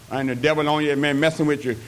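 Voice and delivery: ordinary talk, nothing marked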